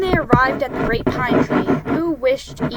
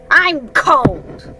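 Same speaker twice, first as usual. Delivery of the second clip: louder, higher complaining moan; 4 semitones higher, 7 dB louder than usual. A narrator's reading. Tigger moaning while waiting for Owl, loud complaint. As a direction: moan it out, pushing the words. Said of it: "moaned Tigger".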